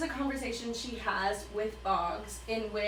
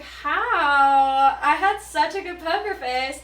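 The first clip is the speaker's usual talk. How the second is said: much higher and louder, a hammed-up shocked Katniss; mock indignant surprise; 6 semitones higher, 11 dB louder, slower than usual. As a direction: play her as outraged she got caught.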